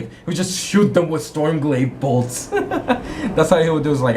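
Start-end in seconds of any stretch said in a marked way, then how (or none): 2.5-3.3 short high burst of real laughter. Real, not acted. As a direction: laugh at your own joke. Laughing at own story.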